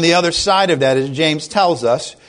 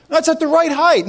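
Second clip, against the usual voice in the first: builder replying; 11 semitones higher than usual. Retold or imitated. Builder answering the investor.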